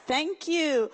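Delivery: ordinary talk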